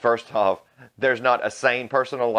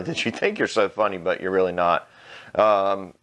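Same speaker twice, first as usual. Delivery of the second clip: usual speaking voice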